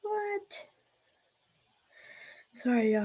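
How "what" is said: normal talking voice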